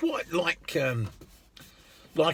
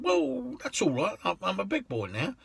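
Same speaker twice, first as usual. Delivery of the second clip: much higher, jokey reassurance; reassuring; 7 semitones higher, 3 dB quieter than usual. Retold or imitated. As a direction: brush it off lightly. Driver reassures swearing passenger.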